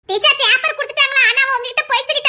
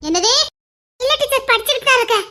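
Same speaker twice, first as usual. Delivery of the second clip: ordinary delivery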